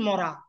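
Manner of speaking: মরা said softly and low, the reversed form of রাম; name said backwards. Shown as a demonstration. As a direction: drop to a low, soft মরা as the sinner's mistake. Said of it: "রামকে কি উচ্চারণ করেছেন?". (নরম নিচু স্বরে উল্টো উচ্চারণ মরা)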